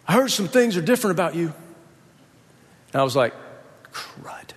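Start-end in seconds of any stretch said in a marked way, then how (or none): 0.1-1.5 mock yell, a bit higher; raised, earnest retold call; retold serious yell. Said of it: "he yells over, real serious face". 3.9-4.5 whispered mock dismay; hushed, breath-only oath; dismayed aside. Muttered dismay at being found out.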